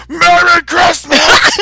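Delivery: furious mob member shrieking Merry Christmas; werewolf roar. Retold or imitated. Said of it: "metamorphing into a werewolf".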